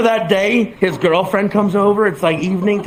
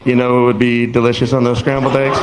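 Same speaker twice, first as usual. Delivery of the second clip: deadpan baiting; 9 semitones lower than usual. Retold or imitated. Teller baits roommate with deadpan setup.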